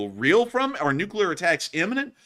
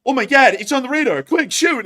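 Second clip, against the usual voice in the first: much higher, louder panicked yell; mock panic; 11 semitones higher, 9 dB louder than usual. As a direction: shriek the order in a frantic rush. Panicking Russian soldier at radar.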